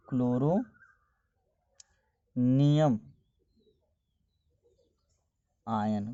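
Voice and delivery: very slow, chloronium stretched with long gaps; drawn-out dictation. Real, not acted. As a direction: spell out the term piece by piece. Dictating new term while writing it. (लिखते हुए बहुत धीरे, खींचकर बोलना)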